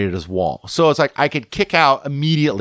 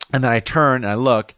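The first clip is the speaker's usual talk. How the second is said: ordinary delivery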